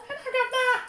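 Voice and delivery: very high mock cockney "Hello, Governor". Playfully repeating the host's cockney greeting. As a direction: squeak it like a cartoon cockney. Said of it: "Dave Anthony's is like".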